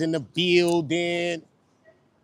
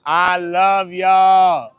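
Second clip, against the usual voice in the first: drawn out love; 4 semitones higher, 11 dB louder, much slower than usual. Real, not acted. Thanking the viewers.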